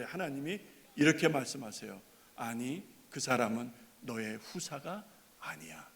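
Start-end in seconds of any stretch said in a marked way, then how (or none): 2.3-5.9 slow hushed whisper. Acted. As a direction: breathe it as a secret answer. God's reply quoted softly. (느리게 속삭이듯)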